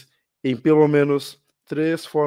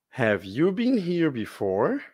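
slow teacher demo; 3 semitones higher, slower than usual. Shown as a demonstration. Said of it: "lesse comigo".

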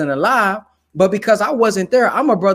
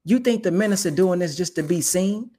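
normal talking voice

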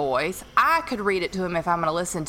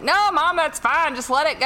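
put-on high, louder pleading kid; protesting son; 10 semitones higher, 4 dB louder than usual. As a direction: plead with mom in a raised pitch. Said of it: "Nate kept saying".

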